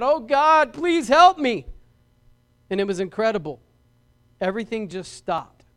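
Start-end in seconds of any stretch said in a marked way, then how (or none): 0.0-1.7 high, loud desperate plea to God; panicked shout, pitched way up; terrified cry. Acted. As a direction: cry out for help in sheer terror. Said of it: "fright and sheer terror, he cried out".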